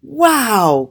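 high, loud wow of delight; amazed cry. A narrator's reading. Raven seeing his new feathers, crying out in amazement. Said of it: "cried Crawford as he looked".

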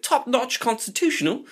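high-pitched mock British accent; put-on British accent. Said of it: "the changing of the accent".

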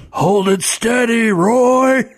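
high shouted character voice. Acted.

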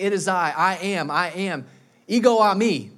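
loud, ringing declaration; calling out as Jesus. Acted. Jesus calls to disciples on the lake, loud proclamation. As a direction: call it out across the water. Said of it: "Jesus to call out and say".

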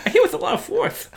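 high laughing lisping Tyson voice; Mike Tyson impression. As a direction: lisp it high through a laugh. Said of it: "your Tyson impression".

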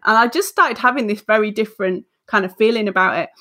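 normal talking voice

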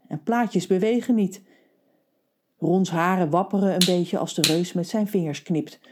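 ordinary delivery, nothing marked

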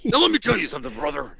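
Hulk Hogan voice. Retold or imitated.

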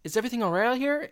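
put-on high, fast little-kid question. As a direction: pipe it up like a small boy. Kid voiced checking on adults.

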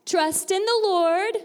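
high, slow, projected classroom call; teacher calling out a line to echo; call for repetition. Shown as a demonstration. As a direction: lead the group to repeat, loud and drawn out. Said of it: "repeat after me".

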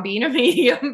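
laughing through the words; amused, laughing as you say it. Real, not acted. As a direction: let a real chuckle break into the phrase. Laughs at her own nickname.

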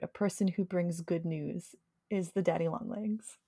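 usual speaking voice

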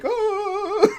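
high laughing yelp of fright; panicked cry. Retold or imitated. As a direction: yelp as the crowd bolts. Everyone running away in panic.

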